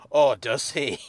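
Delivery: hammed-up dramatic question; mock suspense. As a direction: drag it out like a cheesy cliffhanger. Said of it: "element of suspense".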